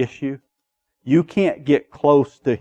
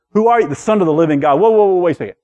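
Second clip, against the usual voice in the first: higher, louder mock outrage; irritated objection; 6 semitones higher, 5 dB louder, faster than usual. Preacher acting religious people challenging Jesus, irritated objection. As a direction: cut in loudly like an affronted objector. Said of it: "just irritated all the religious people".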